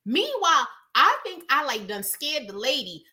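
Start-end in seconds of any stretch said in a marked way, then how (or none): none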